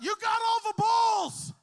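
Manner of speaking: high, loud, drawn-out cheering shout; yelled encouragement. Retold or imitated. Pastor cheering on the girl from the sidelines, shouting. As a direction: yell it across the gym to the girl. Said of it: "So I just yelled out".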